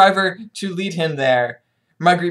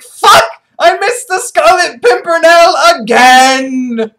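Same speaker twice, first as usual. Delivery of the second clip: loud, high, hammed-up roar; frustrated villain yell; 8 semitones higher, 10 dB louder, much slower than usual. Villain finding the cabin deserted, frustrated mock shout. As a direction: howl it slowly in mock fury. Thwarted villain's acted outburst.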